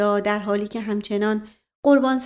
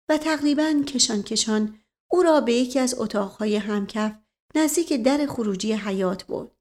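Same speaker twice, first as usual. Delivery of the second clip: plain reading voice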